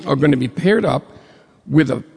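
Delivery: normal talking voice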